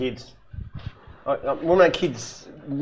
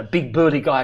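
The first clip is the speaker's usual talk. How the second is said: ordinary talk, nothing marked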